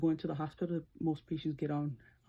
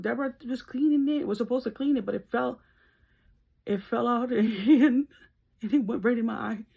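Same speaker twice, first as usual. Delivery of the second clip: higher-pitched, acted-out frantic account; upset child explaining; 6 semitones higher, 6 dB louder than usual. Child telling mom about sister's accident, imitated distress. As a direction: plead your case to mom in a mock-distressed, raised voice.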